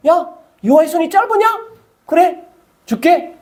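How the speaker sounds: high, casual toss-off; breezy offhand reply. Retold or imitated. God answering Moses, high and breezy. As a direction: shrug it off lightly. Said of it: "하나님은 간단하게".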